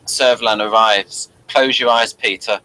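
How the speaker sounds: ordinary talk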